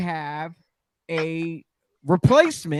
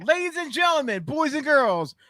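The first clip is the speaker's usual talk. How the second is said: higher-pitched, showy announcer call; announcer address; 6 semitones higher than usual. Acted. Host presenting guest to the audience, showy announcer style. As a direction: address the crowd like a ring announcer. Host restarts the grand introduction.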